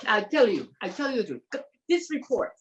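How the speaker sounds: a light impression of a Russian woman's accent; her accent. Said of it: "I'm doing my accent of her".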